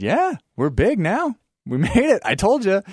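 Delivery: hammed-up proud boss; boastful. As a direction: gloat playfully at the old coworker. [name] bragging to returning Kevin.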